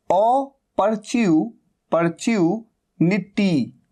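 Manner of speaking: अपॉर्चुनिटी split into ओ, परच्यू, निटी; syllable breakdown. Shown as a demonstration. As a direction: sound out ओ, परच्यू, निटी part by part. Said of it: "अपॉर्चुनिटी कैसे लिखा जाता है".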